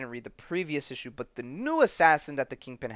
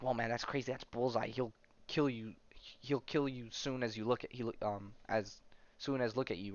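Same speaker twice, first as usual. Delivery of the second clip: hushed whispering; 8 dB quieter than usual. Retold or imitated. Inmate warning another about Bullseye, hushed whispering. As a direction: drop to a soft, secretive murmur. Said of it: "The little dotted lines mean they're whispering".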